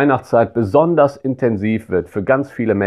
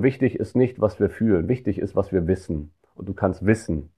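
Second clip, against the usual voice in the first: soft closing assurance; 5 semitones lower, 7 dB quieter than usual. Acted. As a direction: lower and much softer, tender blessing. Closing blessing to listener.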